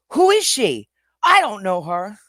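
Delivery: high, loud dismissive voice; mocking protester. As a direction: toss it off like a shifty liar. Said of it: "he played it off by saying".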